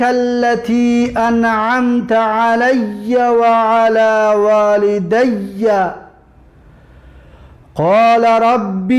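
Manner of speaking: slow, drawn-out chanted recitation; measured tajwid chant; Quran recitation. Acted. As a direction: intone each verse slowly and evenly.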